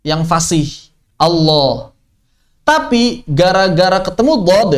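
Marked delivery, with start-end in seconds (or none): 1.2-1.9 "Allah" with a heavy dark l and o-like vowel; thick lam. Shown as a demonstration. Teacher showing the proper thick lam, "Allah" said dark and heavy. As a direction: say "Allah" fully thick, as "Allooh". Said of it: "lam tebel itu hanya ada pada lafaz jalalah".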